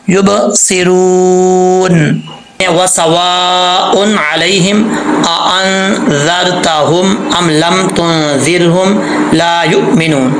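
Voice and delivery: measured tajweed chant; slow Quran recitation. Shown as a demonstration. Teacher reciting the next verse for the student. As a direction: recite the verse slowly and evenly.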